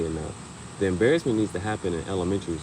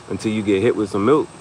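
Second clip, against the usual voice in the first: ordinary delivery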